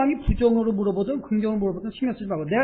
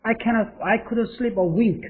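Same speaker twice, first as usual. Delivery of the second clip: slow model read; 3 semitones higher, much slower than usual. Shown as a demonstration. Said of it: "teacher models the sentence".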